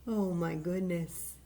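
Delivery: plain reading voice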